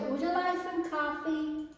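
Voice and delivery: very slow, every word laid out for learners; slow, careful classroom English; slow model reading. Shown as a demonstration. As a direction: read it slowly like a teacher modelling. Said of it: "그럴 때 뭐라고 얘기하죠?".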